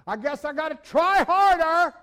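high, loud pleading voice of a struggling sinner; a sinner voiced as a loud, high wail; mock sinner's whine. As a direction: play the desperate sinner begging to try harder.

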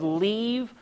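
extremely drawn-out delivery; solemn command. Retold or imitated. Preacher quoting God's command, slow and deliberate. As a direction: linger on every word. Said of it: "When he said".

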